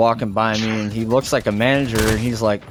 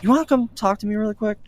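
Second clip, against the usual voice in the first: quick, higher mock manager voice; imitating the manager; 11 semitones higher, faster than usual. Manager inviting the customer to talk, lightly mimicked higher. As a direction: toss it off fast and up high like the manager.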